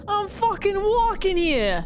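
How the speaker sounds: put-on high, drawn-out Chicago accent; hammed-up Space Marine from Chicago; thick Chicago accent. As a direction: yell it slowly in a broad Chicago accent. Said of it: "Space Marine with a thick Chicago accent".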